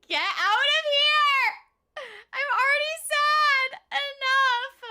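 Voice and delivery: high, loud, laugh-crying yell; laughing shout. Real, not acted. Streamer yelling at teasing chat, laughing and crying. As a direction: yell it while laughing through tears.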